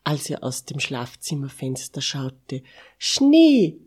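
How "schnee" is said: high, loud cry of excitement; excited cry. A narrator's reading. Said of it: "Er war so aufgeregt". (aufgeregter Ausruf, hoch und laut)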